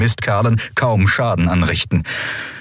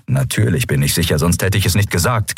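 ordinary delivery, nothing marked

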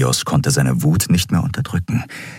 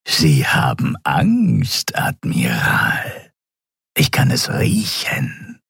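slow, whispered creature taunt; close whisper; 5 semitones lower, slower than usual. Acted. Reptile prisoner whispering close to the admiral's face. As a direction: breathe it in his face. Beak touching admiral's nose.

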